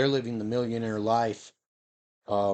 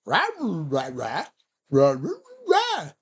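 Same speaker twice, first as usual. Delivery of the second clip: cartoon dog barks jumping much higher; Scooby-Doo voice; 4 semitones higher than usual. Guessing how the talking dog speaks, goofy cartoon dog. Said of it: "or does he talk like Scooby-Doo?".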